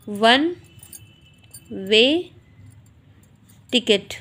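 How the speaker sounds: "वन way ticket" with a long pause after each word; word-by-word dictation. Shown as a demonstration. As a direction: dictate "one way ticket" slowly, one word at a time. Dictates word by word while writing. ("वन way ticket" एक-एक शब्द रुककर, लिखवाते हुए)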